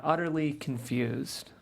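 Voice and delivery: ordinary talk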